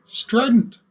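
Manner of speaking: high, loud, piercing "strident"; sharp strident cry. Shown as a demonstration. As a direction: cut through the room with it. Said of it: "it can sound all sorts of different ways".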